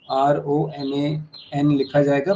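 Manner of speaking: ordinary delivery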